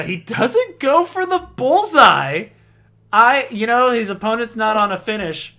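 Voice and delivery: loud, high disbelief at the play; excited commentary. Real, not acted. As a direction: call it out, voice soaring in protest. Live call of surprising throw choice.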